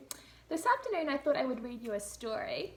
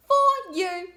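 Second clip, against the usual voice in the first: much higher and louder delighted little one; beaming toddler; 8 semitones higher, 14 dB louder than usual. Acted. The little one handing toys to mum, high and beaming. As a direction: offer it like a thrilled toddler. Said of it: "he beamed".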